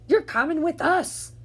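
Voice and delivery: loud high pirate cry; pirate shocked at the wrong beach; pirate exclaim. Acted. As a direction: shout it like a startled captain. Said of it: "Braid Beard told me".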